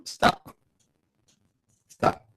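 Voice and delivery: ordinary talk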